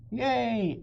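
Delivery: put-on high, loud cheer of a girl; shouted yay. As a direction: cheer it out into the sunlight. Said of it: "Annie shouted".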